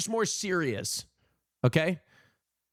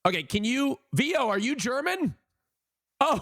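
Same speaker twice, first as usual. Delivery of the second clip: ordinary talk, nothing marked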